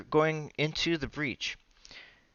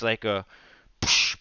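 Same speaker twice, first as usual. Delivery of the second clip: ordinary delivery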